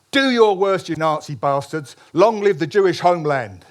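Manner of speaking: loud, high defiant cry at the firing squad; defiant shout. Retold or imitated. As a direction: shout it out like a proud old man.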